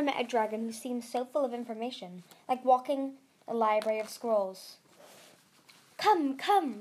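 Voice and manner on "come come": a touch of eager hurry, higher; beckoning keenly. A narrator's reading. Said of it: "Mastermind said eagerly".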